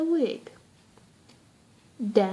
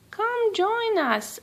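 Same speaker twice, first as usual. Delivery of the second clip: calling out; 10 semitones higher, slower than usual. Acted. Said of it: "one of the maids called".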